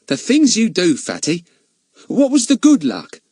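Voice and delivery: put-on high eager boy. A narrator's reading. Ern asks eagerly.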